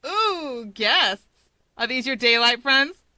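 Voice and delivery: delighted to find guests, poke fun; gleeful loud tease; teasing Mirko. A narrator's reading.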